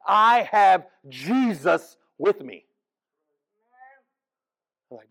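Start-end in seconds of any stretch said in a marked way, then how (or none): 0.1-2.7 slow, loud smug declaration; a churchgoer smugly brushing off someone in need; smug believer. Retold or imitated. Mocking self-satisfied believer.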